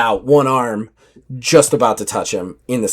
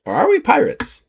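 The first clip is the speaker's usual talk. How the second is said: teasing navigator question; 13 semitones higher than usual. Acted. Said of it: "looks at Indigo and goes".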